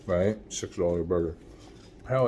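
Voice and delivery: usual speaking voice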